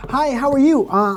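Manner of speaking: ordinary talk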